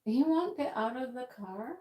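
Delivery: ordinary talk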